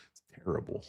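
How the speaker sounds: ordinary delivery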